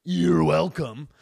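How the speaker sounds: slow, drawn-out smug "You're welcome"; mock him dragging it out; smug man imitation. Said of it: "he was saying".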